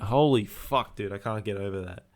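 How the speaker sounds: usual speaking voice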